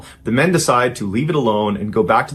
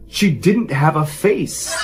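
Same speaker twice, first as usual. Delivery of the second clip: slow, dazed horror, half whispered to herself; stunned, slow reveal; 4 semitones higher, slower than usual. Retold or imitated. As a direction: let the horror sink in slowly. Terrified mother reveals what she saw.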